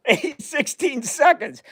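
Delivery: high-pitched, cracking up between words; helpless laughter breaking into the questions; real laughing. Real, not acted. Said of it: "Why I'm laughing".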